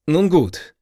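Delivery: forced courtesy; stiffly polite. A narrator's reading. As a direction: bite back anger, stay polite. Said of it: "mit so viel Artigkeit". (steif höflich)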